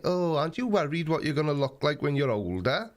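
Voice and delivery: normal talking voice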